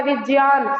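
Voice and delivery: "विज्ञान" said as vij-yaan; Sanskrit pronunciation. Shown as a demonstration. Teacher applying the correction for students, "विज्ञान" as vij-yaan. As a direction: use a j, not g, in "विज्ञान". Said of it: "ये ज्या होता है".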